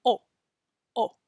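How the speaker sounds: pure Spanish "O" with lips held round and no W; "O" without closing the lips at the end. Shown as a demonstration. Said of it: "make our mouth the shape of a letter O".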